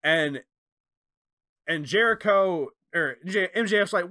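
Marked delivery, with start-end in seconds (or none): none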